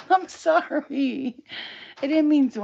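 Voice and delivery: high, drawn-out sorry; cooing apology. Real, not acted.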